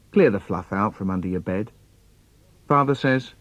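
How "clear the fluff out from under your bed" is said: lightly voiced mum, low pitch; a hint of a mother instructing; mum's order. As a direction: give a low, matter-of-fact order as mum. Mum's order in acted poem.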